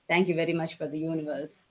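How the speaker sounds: usual speaking voice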